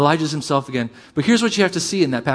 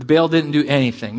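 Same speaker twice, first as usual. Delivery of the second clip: usual speaking voice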